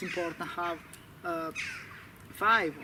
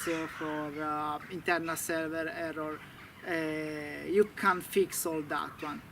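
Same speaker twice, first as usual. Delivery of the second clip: ordinary delivery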